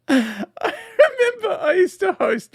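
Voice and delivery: high, slow words through laughter; laughing. Real, not acted. Host begins a nightclub memory, laughing. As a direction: start the story while cracking up. Starts anecdote while cracking up.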